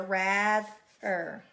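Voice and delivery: "rather" broken after the th, rath ... er; split into syllables. Shown as a demonstration. Teacher showing the digraph kept together, "rather" split rath ... er. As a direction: say "rather" in two pieces, holding th with the first. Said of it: "keep that consonant digraph together".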